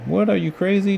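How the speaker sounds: high, startled shout; shouted in disbelief. A narrator's reading. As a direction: yell back in disbelief. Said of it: "Jessie shouted back".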